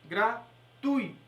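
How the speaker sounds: "Gratuito" very slow, gra-TUI-to, "tui" as one syllable; "Gratuito" drawn out slowly with TUI stressed as a diphthong; slow correct pronunciation. Shown as a demonstration. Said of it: "a sílaba tônica é tu".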